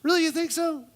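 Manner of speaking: very high, slow "so cute"; hammed-up squealing friend; mock-girly gushing; gushing woman. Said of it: "Woman number one".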